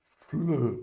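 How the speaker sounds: rounded lips as for O while saying E; French eu sound. Shown as a demonstration. Teacher modelling fleu for Brazilian students, lips rounded. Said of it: "você faz a boquinha do O e pronuncia o E".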